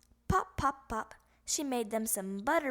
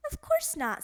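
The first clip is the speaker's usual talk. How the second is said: squeaky, much higher denial; too-high denial; 4 dB louder than usual. Acted. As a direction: deny it in a squeak. Nervous frog denies.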